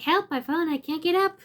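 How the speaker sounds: mildly hammed-up helpless plea; fallen robot's plea. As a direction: play a toppled robot calling for help. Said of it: "one of the robots have fallen over".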